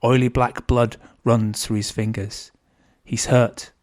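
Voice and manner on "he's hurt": ordinary delivery, nothing marked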